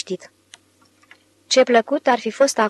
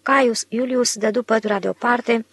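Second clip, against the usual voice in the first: usual speaking voice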